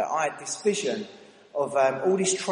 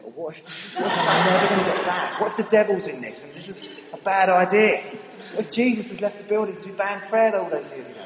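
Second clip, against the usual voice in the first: mock panicky worry; 5 semitones higher, 6 dB louder than usual. Acting out spiralling worries at door.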